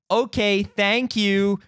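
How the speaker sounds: put-on courteous acknowledgement; feigned politeness. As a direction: imitate a guest politely pretending to understand. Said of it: "they were being polite".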